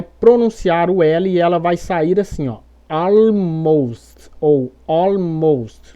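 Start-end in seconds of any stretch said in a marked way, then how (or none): 2.9-6.0 almost very slowly with a heavy L; exaggerated L. Shown as a demonstration. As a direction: overdo the L in almost, stretched out. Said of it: "dando uma exagerada nesse L".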